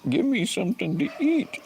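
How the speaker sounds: put-on high squeaky voice for the crying baby bird; voicing hungry baby bird. Streamer voices the hungry baby bird, high squeaky begging. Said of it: "He's going".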